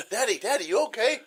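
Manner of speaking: put-on high, worried child calling; little girl voice. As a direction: call out like a scared little girl. Daughter voiced, rising.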